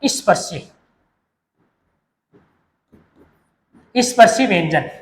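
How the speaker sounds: soft drill of स्पर्शी; term said low. Shown as a demonstration. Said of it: "तीन कौन कौन से भाग हैं".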